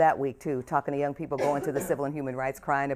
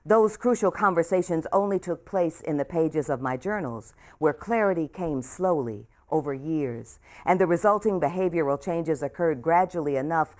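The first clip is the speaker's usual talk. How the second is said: a clear, louder reading voice for the audience; memoir reading; 2 semitones higher, 5 dB louder than usual. Acted. Author reading her memoir aloud to a hometown audience. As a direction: read the pages out to the room with care.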